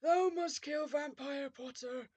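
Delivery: slow, drawn-out villain voice; yelling villain. A narrator's reading. Voldemort commanding Ebony, slow villain voice. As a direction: intone it as a grand evil command. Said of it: "he yelled".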